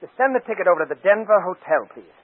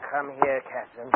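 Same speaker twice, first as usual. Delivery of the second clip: usual speaking voice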